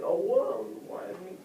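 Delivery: high-pitched mock wail of grief; hammed-up woe-is-me lament; mock crying. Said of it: "they're just crying like".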